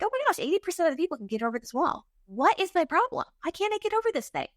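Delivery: high-pitched, exasperated self-questioning; bothered, exasperated. Retold or imitated. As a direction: beat yourself up in a high, incredulous voice. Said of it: "it really bothered me".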